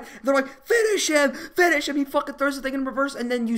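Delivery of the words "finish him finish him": high, urgent imitated bullies; mock egging-on. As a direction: urge him on like a bully. Voices bullies urging a killing.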